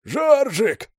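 high, loud, hoarse joyful cry; joyful rasp. A narrator's reading. Kruger greets Zhorzhik over the radio, joyful and hoarse. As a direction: rasp his name with delight. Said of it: "радостно просипел голос".

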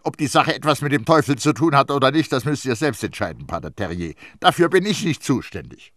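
hurried opening, loud defensive disclaimer; dodging responsibility; evasive. A narrator's reading. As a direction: pass the decision off to him. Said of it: "antwortete sie ausweichend".

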